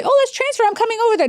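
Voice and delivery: high-pitched, sing-out eager offer; mock eagerness. Hospice executive imagining jumping at a transfer, mock eagerness. Mocking an over-eager response she rejects.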